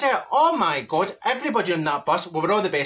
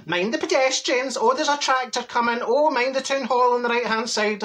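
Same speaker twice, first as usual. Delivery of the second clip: higher-pitched fussy warnings from the back seat; nagging back seat driver; 6 semitones higher than usual. Acted. Back seat passenger warning the driver, nagging. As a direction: call out one anxious warning after another.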